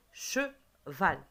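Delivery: "cheval" split into che and val, with a gap; "cheval" broken in two for a class; syllable by syllable. Shown as a demonstration. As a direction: tap out "cheval" in two slow beats. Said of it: "je tape les syllabes".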